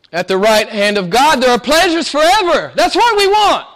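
full-force preaching. Acted. Preacher crying out the disciples' longing to the church, high and loud. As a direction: lift the congregation, stretching each phrase.

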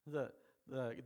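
normal talking voice

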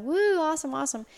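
high, thrilled whoop of joy; excited whoop. Retold or imitated. Hunter cheering her first turkey, excited whoop. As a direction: cheer like you just nailed your first shot.

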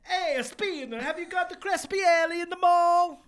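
loud, high cartoon Italian accent. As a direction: play a pizza chef in an Italian accent. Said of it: "in an Italian accent".

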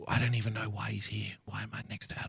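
a whispered aside like a fan baffled by his seat neighbour; hushed aside. Pretend. Fan whispering to camera beside Hitler cutout, softly bewildered.

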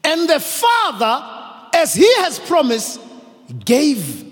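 slow, soaring high sermon proclamation; soaring preaching. Acted. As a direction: lift the verse up to heaven. Elevated preaching, high pitch.

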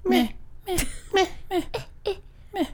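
bored, flat mock moan, fading to a tiny high squeak; mocking blah blah. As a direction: drone out a bored meh, trailing off. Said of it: "That's the sound she makes during sex".

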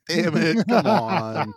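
slow, drawn-out groaning complaint; half-laughing exasperated protest; mock exasperation. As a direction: drag the words out in playful frustration. Retold exasperated reaction to a parody.

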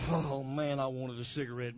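ordinary talk

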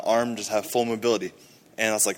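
ordinary talk, nothing marked

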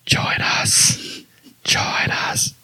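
spooky whispered chant; creepy whisper. Pretend. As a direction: breathe it like a ghostly message. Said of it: "secret [name] backwards message".

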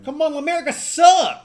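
shouting in playful protest; loud protest. Real, not acted. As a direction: yell it like a heated fan. Arguing loudly about song.

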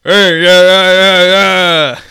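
loud high monster bellow; monster yelling. Acted. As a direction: roar it out at full volume.

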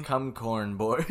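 slow stoned drawl; spaced-out and high; stoner. Shown as a demonstration. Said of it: "I'm so high right now".

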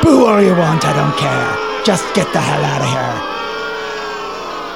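slow sneering taunt at the crowd; sneering wrestling villain. Acted. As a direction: goad the booing crowd slowly.

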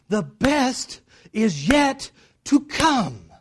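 loud, high, very slow declaration; booming proclamation. Real, not acted. Preacher declaring what the Lord is saying, booming proclamation. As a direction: proclaim it, drawing out each beat.

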